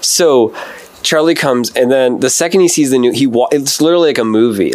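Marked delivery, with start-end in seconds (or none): none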